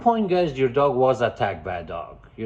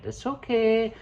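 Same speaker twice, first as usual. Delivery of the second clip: high soothing coo; cooing owner; 10 semitones higher than usual. Retold or imitated. Owner petting aggressive dog, high baby talk. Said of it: "you're petting it".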